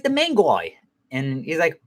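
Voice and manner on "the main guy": high-pitched imitation of a wife; vague wife. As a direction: play the wife answering vaguely. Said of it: "she was very vague".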